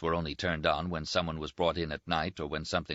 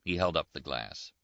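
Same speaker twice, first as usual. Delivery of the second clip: ordinary delivery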